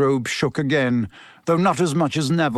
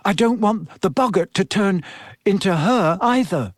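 higher-pitched, mildly anxious schoolboy; nervous; 6 semitones higher than usual. A narrator's reading. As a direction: object with a touch of nerves. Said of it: "said Neville nervously".

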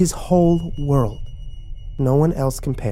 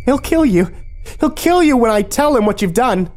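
very high, loud frantic threat; high-pitched, nervous; 13 semitones higher, 4 dB louder than usual. A narrator's reading. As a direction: threaten shrilly through rising panic. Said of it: "high-pitched and nervous".

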